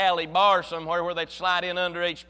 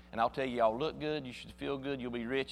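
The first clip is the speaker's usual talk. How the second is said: much softer, lower, still monotone; mock a sleepy prosperity preacher; 7 semitones lower, 8 dB quieter, faster than usual. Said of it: "stand real still and talk real soft in a monotone voice".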